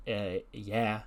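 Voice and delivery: acted bored mumble; uninterested. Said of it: "She's looking uninterested".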